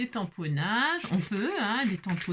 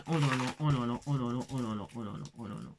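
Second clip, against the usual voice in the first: low, descending chain of sighs of wonder; awed muttering; 9 semitones lower, 3 dB quieter, faster than usual. Real, not acted. Crafter overwhelmed by her finds, low awed muttering. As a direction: sink lower and softer with each repeat. Swooning over newly found stickers.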